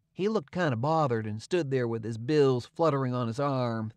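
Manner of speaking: ordinary delivery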